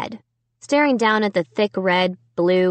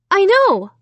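loud high outburst; desperate; 11 semitones higher, 6 dB louder than usual. A narrator's reading. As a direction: burst out desperately. Desperate start.

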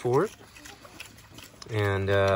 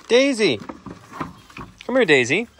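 calling out; 9 semitones higher, 6 dB louder than usual. Real, not acted. Calling pig over.

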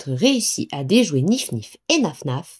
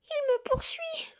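frightened piglet; 11 dB quieter than usual. A narrator's reading.

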